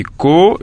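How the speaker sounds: "ko" held and rising; separated syllable. Shown as a demonstration. Teacher numbering the parts of école, each drawn out. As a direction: say ko as a lone second beat. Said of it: "deuxième syllabe".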